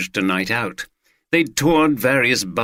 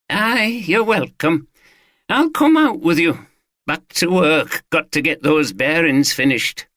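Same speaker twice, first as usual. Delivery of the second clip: raised, eager Yorkshire man proudly explaining his rifles; Watson the gun owner; 7 semitones higher than usual. Acted. Owner showing off his rifles to the detective, lightly voiced and eager. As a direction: answer the detective cheerfully, a touch higher.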